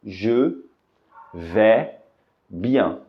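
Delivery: "je" "vais" "bien" split by pauses; slow demo word by word; careful correct pronunciation. Shown as a demonstration. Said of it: "a pronúncia correta é".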